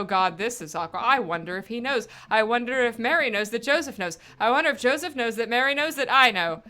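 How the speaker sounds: ordinary talk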